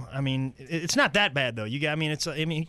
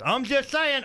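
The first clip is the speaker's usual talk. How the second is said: higher, pushed-up comic character voice; raised mock-innocent preamble; ignorant know-it-all; 11 semitones higher, 3 dB louder, slower than usual. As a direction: say it like someone about to say something stupid. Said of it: "they get that look in their eyes".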